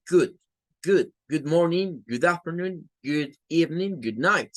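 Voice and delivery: good with the short, lax oo of book. Shown as a demonstration. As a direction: model each good greeting briefly and separately. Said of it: "Tienen el mismo sonido".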